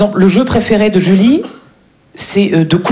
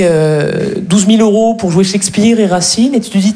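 ordinary talk, nothing marked

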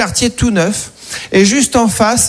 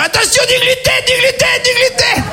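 high-pitched warning yell, pitched way up; mock alarm cry. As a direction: cry out a fake alarm, repeating it like a siren. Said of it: "je reviens en criant".

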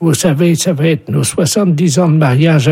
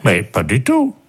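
put-on old husband; 6 semitones lower, 4 dB quieter than usual. Husband protests aside to wife.

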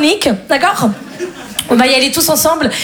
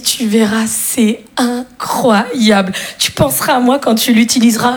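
suggestive friend; 5 semitones lower, slower than usual. Retold or imitated. Friend's suggestive line voiced.

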